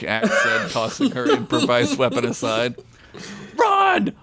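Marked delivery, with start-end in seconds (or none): 3.6-4.1 high sudden shout. Acted. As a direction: yell it out in alarm. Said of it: "Ugla shouted".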